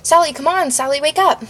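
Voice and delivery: high, urgent coaxing, not whispered; urgent plea. A narrator's reading. Narrator begs unconscious Sally to wake up, urgently pleading. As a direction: plead for a response, pitch lifted. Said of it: "I whispered".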